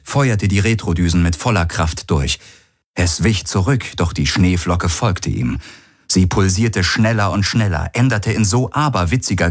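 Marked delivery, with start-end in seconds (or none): none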